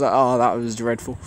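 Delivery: normal talking voice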